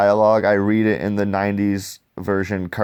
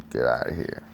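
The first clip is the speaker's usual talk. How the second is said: low, much softer growl; low menacing line; 8 semitones lower, 7 dB quieter than usual. Acted. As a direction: say it low and quiet like a threat. Reading comic dialogue aloud.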